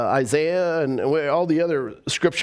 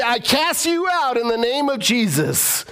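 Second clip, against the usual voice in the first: a hint of a commanding rebuke, higher; commanding prayer; 7 semitones higher than usual. Shown as a demonstration. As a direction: command the demon out. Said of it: "you just say".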